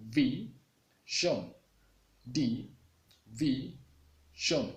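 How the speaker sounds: division split into D, V, shon with pauses; syllable breakdown. Shown as a demonstration. Teacher breaking division into parts, slow and separate. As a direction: chop division into its three beats. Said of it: "break it down into parts".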